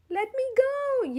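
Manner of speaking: high, loud cry for help; a small voice yelling in distress; desperate little yell. A narrator's reading. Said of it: "yelled the voice".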